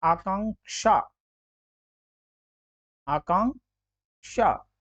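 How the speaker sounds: आकांक्षा very slowly, pause before क्षा; slow syllable-by-syllable आकांक्षा with clear "ksha"; correct careful pronunciation. Shown as a demonstration. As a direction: split आकांक्षा into आकां, then drawn-out क्षा. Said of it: "शुद्ध बोलना जरूर पड़ेगा".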